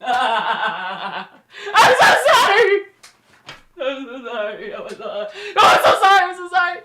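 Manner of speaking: hammed-up fake crying apology; swinging between loud mock wails and whimpers; mock sobbing sorry. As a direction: wail the sorries like a fake sob.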